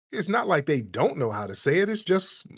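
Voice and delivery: ordinary talk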